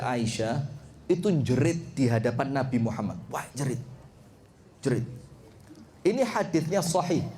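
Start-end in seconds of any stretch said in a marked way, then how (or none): none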